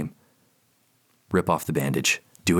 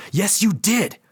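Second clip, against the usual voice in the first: sharp, much higher angry outburst; slower than usual. Acted. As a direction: snap back in fury. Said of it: "Anger flares inside me".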